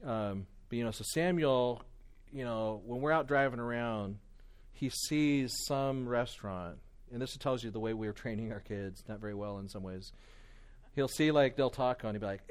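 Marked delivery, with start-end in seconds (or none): none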